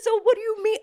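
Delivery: high, loud mock-exasperated question; retold incredulous demand. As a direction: press the man loudly for an answer. Retold quarrel, pressing the man.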